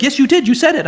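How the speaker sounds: mock squeaky protest from a colleague; indignant coworker. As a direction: insist he said it, rising to a squeal. Acted quarrel with how types.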